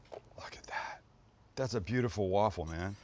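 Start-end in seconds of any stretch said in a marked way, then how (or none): 0.1-1.0 soft breathy hush; awed whisper. Real, not acted. Chef whispering in awe at his waffle.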